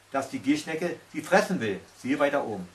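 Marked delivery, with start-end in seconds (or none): none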